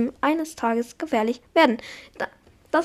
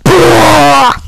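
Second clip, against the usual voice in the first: relieved shout; 3 semitones higher, 13 dB louder than usual. Real, not acted. Relief after finishing sentence.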